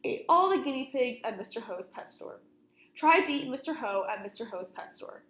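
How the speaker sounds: plain reading voice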